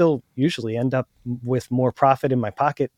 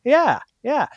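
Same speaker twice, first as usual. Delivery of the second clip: much higher, eager yes; agreeable seller; 10 semitones higher than usual. Acted. Seller agreeing to look for something else, high and agreeable. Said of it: "they'll go".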